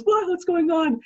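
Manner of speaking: very high, alarmed outburst; shocked cry. Acted. Wife seeing the pot thrown out, shrieking in shock. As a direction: shriek it in horror.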